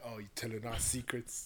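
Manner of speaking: soft teasing accusation; hushed accusing wife. Retold or imitated. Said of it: "She's gonna be like".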